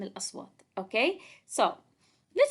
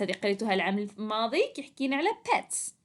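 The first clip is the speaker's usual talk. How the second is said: ordinary delivery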